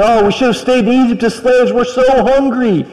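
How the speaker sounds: high whiny complaint; grumbling Israelites. Retold or imitated. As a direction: whine like hungry ungrateful people. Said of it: "grumbling and saying".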